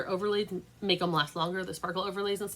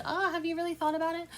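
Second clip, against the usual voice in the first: gentle sales nudge; 10 semitones higher than usual. Retold or imitated. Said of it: "And I did say".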